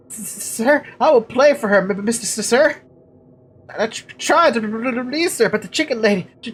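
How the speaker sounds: high, halting villager stammer; pleading simpleton with a put-on stutter; stuttering character voice. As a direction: trip over first sounds in a high, eager voice. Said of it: "really hard to do the stutter".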